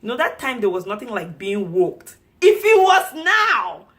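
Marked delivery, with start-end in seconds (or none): 2.4-3.9 high, loud fired-up outburst; worked-up yell, high and forceful; indignant shout. Real, not acted. Indignant at how she would respond now.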